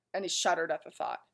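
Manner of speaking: ordinary delivery, nothing marked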